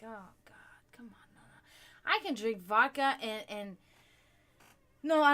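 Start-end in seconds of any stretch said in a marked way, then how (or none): 0.0-1.6 softly whispered plea against the whiskey; whispered dread. Retold or imitated. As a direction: breathe it under your breath in dismay. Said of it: "I'm like".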